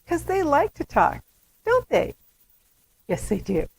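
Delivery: put-on high sing-song voice to a baby; cooing parent talking to an infant. Shown as a demonstration. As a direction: coo at the infant and pause for a reply. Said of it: "leave a break".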